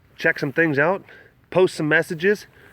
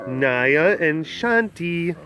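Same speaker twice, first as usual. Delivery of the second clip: ordinary talk, nothing marked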